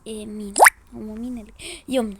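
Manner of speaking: usual speaking voice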